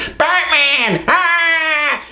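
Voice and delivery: a hint of the goofy yelp; quick nod to the extra's cry; dorky cry. Vlogger quotes the dubbed voice, light mock yelp. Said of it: "because the voice".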